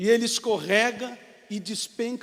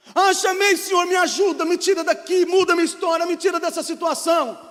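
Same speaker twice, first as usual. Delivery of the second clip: higher, louder begging; desperate pleading; 7 semitones higher, 6 dB louder than usual. Acted. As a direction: plead frantically to be rescued. Climber begs God from the rope.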